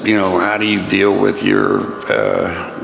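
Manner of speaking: usual speaking voice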